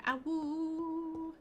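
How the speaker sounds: high drawn-out wolf howl. Pretend. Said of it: "That's a sound that vampires make".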